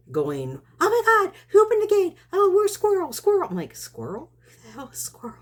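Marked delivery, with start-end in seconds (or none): 0.8-3.5 very high, loud imitation of a frantic neighbour; mock panic. Neighbour pulling up to find gate open, high loud mock panic. 3.8-5.4 much softer, higher puzzled aside; retold bafflement. Woman baffled by neighbour's shouting, hushed puzzled retort.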